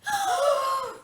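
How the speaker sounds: high, loud startled gasp; big shocked gasp. Shown as a demonstration. Presenter gasping along with the kids, big and shocked. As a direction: gasp as if something terrible just happened. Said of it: "You guys try it with me. Ready?".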